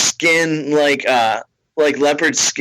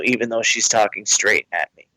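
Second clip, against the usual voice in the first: usual speaking voice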